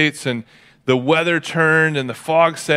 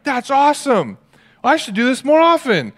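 put-on high, excited kid voice; delighted kid; 12 semitones higher, 3 dB louder than usual. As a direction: gush like a thrilled child. Kid delighted after obeying.

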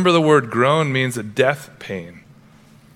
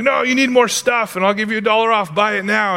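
higher, eager salesman voice of Amazon; mock the store pushing you to buy; pushy Amazon sales pitch; 6 semitones higher than usual.

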